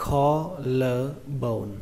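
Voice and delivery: "collarbone" drawn out syllable by syllable; slow model pronunciation. Shown as a demonstration. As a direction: say "collarbone" slowly, in separate parts. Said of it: "Từ này các bạn đọc là". (đọc "collarbone" chậm, tách từng âm)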